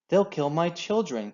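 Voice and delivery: frightened cry. A narrator's reading. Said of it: "cried Missus Fox".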